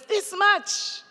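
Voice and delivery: little hare voice. Retold or imitated.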